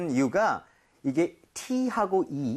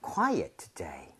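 low, soft whisper; hushed, much lower and softer; playful hush; 11 semitones lower, 5 dB quieter, much slower than usual. Acted. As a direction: drop to a whisper for the quiet house. Reads line about a quiet house.

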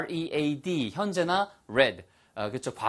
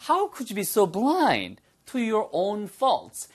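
usual speaking voice

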